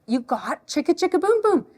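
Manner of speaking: high, animated read-aloud voice; reading to a child. Shown as a demonstration. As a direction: show a picture book to a little one. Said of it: "read it like I would if a child is here".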